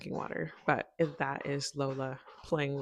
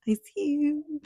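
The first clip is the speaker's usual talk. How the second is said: higher, louder spooked "I see you"; mock spooked; 10 semitones higher, 8 dB louder than usual. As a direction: act a little creeped out. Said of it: "And at first I was like".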